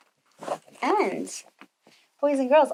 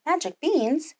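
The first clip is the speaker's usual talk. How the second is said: rising, astonished Jack; amazed child asking about the beans; surprised question; 6 semitones higher, 3 dB quieter than usual. Acted. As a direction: gasp out the question in wonder. Jack hears of magic beans.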